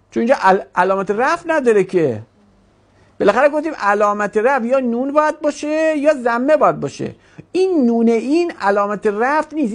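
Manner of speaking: ordinary delivery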